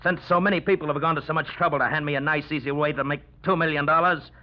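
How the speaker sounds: menacing outburst. Acted. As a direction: let the menace boil over. Gunman turns on everyone.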